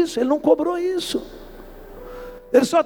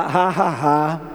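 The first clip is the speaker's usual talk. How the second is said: mocking laugh; 9 semitones lower, much slower than usual. Preacher voices a mocking laugh.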